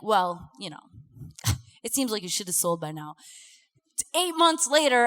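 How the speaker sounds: ordinary delivery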